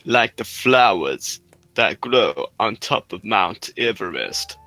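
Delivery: very slow, savouring each word; slow sultry drawl. Pretend. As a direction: drag it out like a seductive ad. Sultry mock ad continuation.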